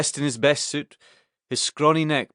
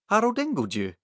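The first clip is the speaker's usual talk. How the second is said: disbelieving high pitched question; can't believe it's him, pitch jumps up; surprised; 9 semitones higher than usual. Acted. Vet surprised at the news.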